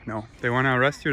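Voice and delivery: ordinary talk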